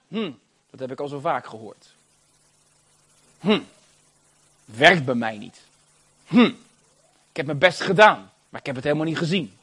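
low-pitched, doubting grumble; voiced sceptic. Preacher quoting the enemy's confession, sceptical. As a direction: act out a doubter with sceptical hums. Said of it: "de belijdenis van de vijand".

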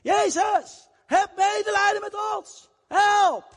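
high shouted plea for help; desperate cry. Acted. Lepers shout to Jesus from a distance, high desperate cry. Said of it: "Ze schreeuwden het uit".

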